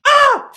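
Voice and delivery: sudden high loud shriek; startled scream. Real, not acted. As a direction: shriek in fright. Startled by gnat swarm.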